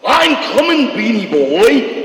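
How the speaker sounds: very high, loud goofy sea serpent call; cartoon sea serpent. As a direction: stretch out Beanie Boy, slow and booming. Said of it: "And he'd be like".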